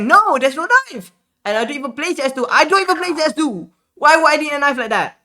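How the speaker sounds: high, loud, incredulous protest; exasperated denial. Retold or imitated. Streamer insisting to the scammer he never bought a knife, high exasperated protest.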